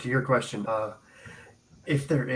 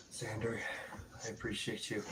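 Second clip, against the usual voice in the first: tender; 16 dB quieter than usual. Acted. Tender thanks to old friend.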